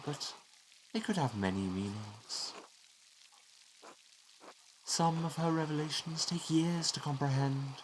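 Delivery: a hint of a kindly Father giving counsel; elderly priest figure, measured. Reads a priest's game dialogue.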